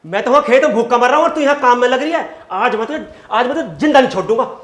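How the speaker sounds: loud, rapid angry outburst; hungry husband's furious tirade. Acted. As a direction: rage at the wife in a loud rush. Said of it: "क्यों गुस्सा हो रहे हो?".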